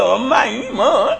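high-pitched, wailing whine, hammed up; mock whining. Daughter phoning her mother to complain, mock whining. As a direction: imitate a daughter nagging in a rising whine. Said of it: "막 그러면서 징징거려".

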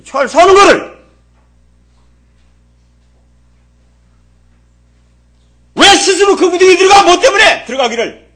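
high, loud pulpit shout; real scolding. Real, not acted. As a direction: thunder reproachful questions at the congregation. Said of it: "그래서 지금 호통치는 거예요".

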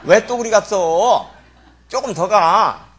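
loud, drawn-out complaint; exasperated scolding. Real, not acted. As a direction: call out slowly to shift someone along. Scolds congregant to move over.

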